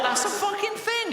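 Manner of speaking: high, startled squeal of disbelief; shocked child. Retold or imitated. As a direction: yelp it like a frightened boy of seven.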